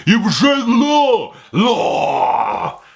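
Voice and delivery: high, loud hammed-up shout; Judge Dredd. Reviewer acting out a funny film scene, mock bellow. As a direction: yell it as an overacting lawman, stretching the last word. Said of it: "that scene alone is funny".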